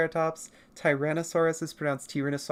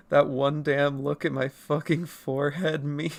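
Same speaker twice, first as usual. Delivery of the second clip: usual speaking voice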